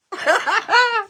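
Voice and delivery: high burst of laughter; loud laugh. Real, not acted. Crafter laughing at own holiday idea, loud.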